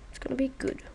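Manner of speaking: breathy hushed whisper; whispered aside. Real, not acted. Whispering to viewers about the coop. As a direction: whisper it to yourself.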